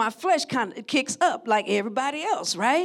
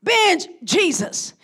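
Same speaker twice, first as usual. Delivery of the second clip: Binge Jesus, slow, higher and louder; emphatic punchline; 6 semitones higher, 4 dB louder, slower than usual. Shown as a demonstration. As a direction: drive home Binge Jesus slowly. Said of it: "sermon punchline repeated".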